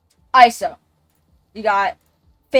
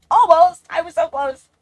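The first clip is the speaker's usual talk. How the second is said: higher and louder, exasperated; real exasperated outburst; exasperated almost; 8 semitones higher, 6 dB louder than usual. Real, not acted. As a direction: cry out over just missing gold. Frustration at just missing a rank.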